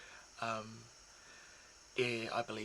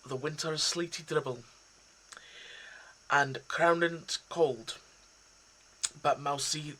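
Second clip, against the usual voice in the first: raised, loud Scottish recital of Burns; Scottish accent; 4 semitones higher, 7 dB louder than usual. Acted. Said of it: "throw in, uh, a Scottish accent".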